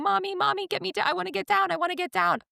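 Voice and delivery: put-on high-pitched, hurried child pleading; whining kid imitation. Little kid calls to mom to get down, pleading.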